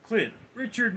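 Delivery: high, bright shout of names; excited greeting. Real, not acted. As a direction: call out the names happily. Greeting viewer by name excitedly.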